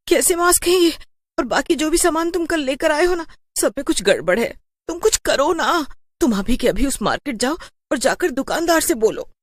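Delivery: high, pleading worried voice; frightened wife. Acted. Wife begging her husband to go back to the market, high and frightened. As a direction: push him to go now, scared.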